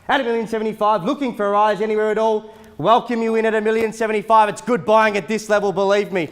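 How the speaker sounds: loud, rapid auctioneer calling; auctioneer calling bids. Acted. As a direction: rattle off the bids to the crowd. Live auction bidding calls.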